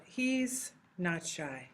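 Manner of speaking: He's not shy drawn out, every word given its own beat; slow and deliberate. Shown as a demonstration. Teacher repeating the sentence slowly so students hear each word. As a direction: spell it out slowly for the class. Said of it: "when you say that in Spanish".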